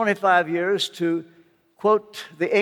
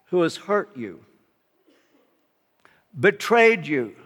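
usual speaking voice

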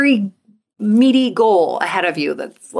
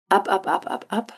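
fading soft; 5 dB quieter than usual. Shown as a demonstration. Balloon drifting away, guided imagery.

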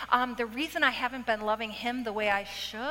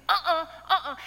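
mock whiny refusal; 6 semitones higher, 3 dB louder than usual. Refusing the book's commands.

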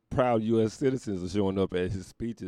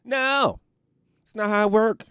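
loud, high, emphatic shout; 10 dB louder than usual. Acted. Outraged at a lazy surgeon, loud high shout. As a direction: yell it down like an outraged patient.

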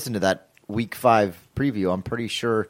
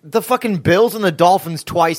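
heated rant; 6 semitones higher than usual. Real, not acted. To the Patriots, a heated, rising rant.

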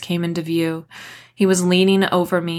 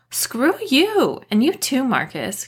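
screech; 7 semitones higher than usual. A narrator's reading. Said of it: "Kat screeched".

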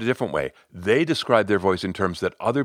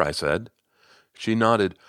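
ordinary talk

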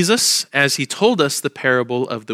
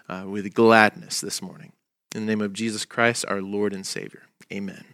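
prayer; 3 semitones lower than usual. Acted. Pastor leads opening prayer.

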